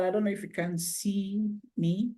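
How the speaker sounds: normal talking voice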